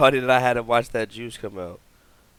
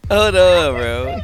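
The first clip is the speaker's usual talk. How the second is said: laughing; 7 semitones higher, 8 dB louder, much slower than usual. Real, not acted. Laughing at the clip.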